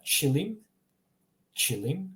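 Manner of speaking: "chilim" with a light soft ch at the start; careful "chilim", opening on soft ch. Shown as a demonstration. Teacher presenting the word for rug, soft ch in "chilim". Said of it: "start with the soft ch".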